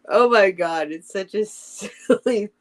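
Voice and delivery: real giggle breaking into the words; amused, laughing at how silly it is. Real, not acted. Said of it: "I don't know why I'm laughing".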